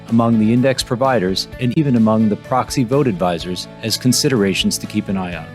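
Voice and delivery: projected voice-over for an episode preview; podcast intro read. Real, not acted. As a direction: read the episode intro with firm, measured emphasis. Pre-recorded podcast introduction.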